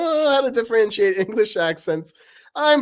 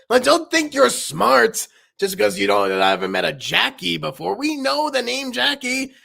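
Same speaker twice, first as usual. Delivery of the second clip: Knife's voice; 6 semitones higher, 4 dB louder than usual. Acted. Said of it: "Paper and Knife talking".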